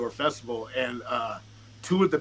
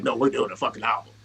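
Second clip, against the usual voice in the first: low, louder, firm correction; imitating insistence; 4 semitones lower, 5 dB louder, faster than usual. Bandmate correcting his doubt about the album, insistent. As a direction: push back firmly, keeping it low.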